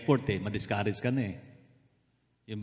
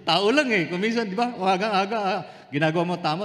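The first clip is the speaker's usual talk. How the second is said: laughing confession; 7 semitones higher than usual. Real, not acted.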